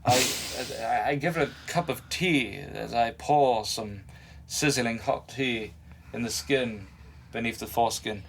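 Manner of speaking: mock British gentleman's accent; hammed-up proper British voice. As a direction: put on a genteel English accent, unhurried. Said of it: "talk like people from U K".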